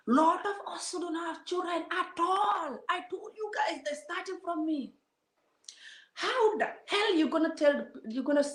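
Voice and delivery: outraged cry at the commenters; indignant outburst. Real, not acted. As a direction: let the outrage burst out high. Ranting at cruel commenters.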